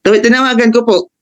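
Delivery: ordinary talk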